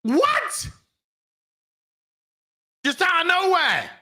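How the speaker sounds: sudden very high squeak of disbelief; a high shriek of total disbelief; stunned what. Real, not acted. Streamer still baffled by the game's twist, squeaks in shock. Stunned reaction to game character.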